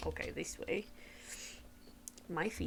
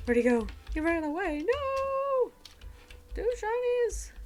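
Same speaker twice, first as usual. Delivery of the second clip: high, loud frantic cries; frantic dismay; 12 semitones higher, 5 dB louder than usual. Real, not acted. Streamer watching a shiny bug flee, loud frantic wail. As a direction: wail as the prize runs off. Rare bug escapes in game.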